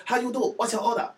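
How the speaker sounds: caricatured Chinese English. Shown as a demonstration. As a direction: overdo the crude Chinese accent. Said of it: "I could do that whole".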